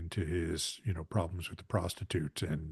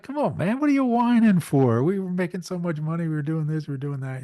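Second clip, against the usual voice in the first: much higher, louder, incredulous guy voice; Rob Lowe impression; 9 semitones higher, 8 dB louder than usual. Podcast host imitating Rob Lowe mocking the Rat Pack, high and loud. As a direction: scoff at the whiners in a big high voice.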